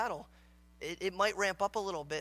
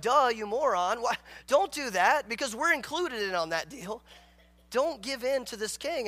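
loud, raised-pitch mock telling-off; hammed-up indignant advisers; exasperated scolding; 5 semitones higher, 7 dB louder than usual. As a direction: scold him as if it's obvious. Acted elders scolding foolish king.

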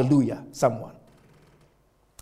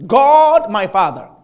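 higher and louder, ringing out each word; preacher leading the prayer full voice; with confidence; 7 semitones higher, 10 dB louder than usual. Shown as a demonstration. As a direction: proclaim it boldly to the congregation. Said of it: "speak it, these words with confidence".

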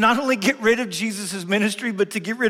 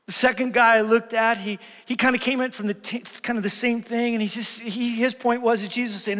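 normal talking voice

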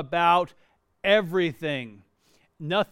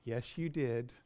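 sweet reply; 6 semitones lower, 7 dB quieter than usual. Retold or imitated. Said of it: "Tammy would go".

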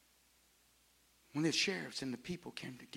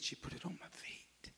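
hushed whisper; 2 semitones higher, 10 dB quieter than usual. Acted. Preacher telling the congregation, whispered. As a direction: whisper it, unvoiced and soft.

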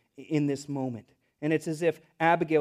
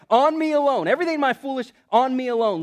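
high, loud declaration as Abigail; insistent plea; 7 semitones higher, 8 dB louder than usual. Acted. Wife takes the guilt on herself, insistent plea. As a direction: plead it loudly, pitched up.

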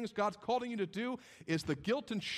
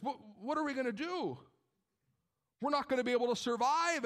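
higher, stumbling, worried pleading with God; Abraham's anxious what-ifs; 3 semitones higher than usual. Acted. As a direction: fret aloud, voice pitched up, tripping over words. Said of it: "imagine the what ifs that Abraham had to deal with".

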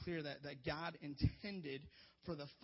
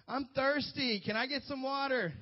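put-on high little-kid plea for water; child asking dad for water; whiny kid; 8 semitones higher, 7 dB louder than usual. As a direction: beg like a thirsty six-year-old. Kid voiced in story.

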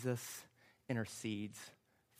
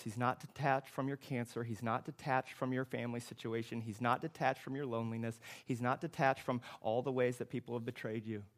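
ordinary talk, nothing marked

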